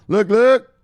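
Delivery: high, loud look look; hype catchphrase. Real, not acted.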